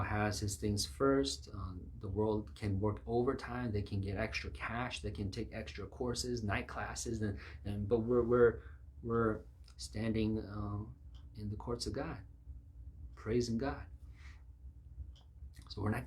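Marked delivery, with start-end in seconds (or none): none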